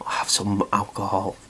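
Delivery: cheeky whispered aside; whispered joke. Real, not acted. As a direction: murmur it like a naughty secret.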